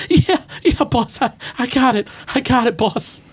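high, cowed henchman squeak; little voice. Acted. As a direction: hurriedly placate the boss in a tiny high voice. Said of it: "the little voice is like".

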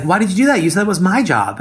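high-pitched imitation of a wounded husband; mock protest.

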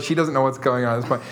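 ordinary delivery

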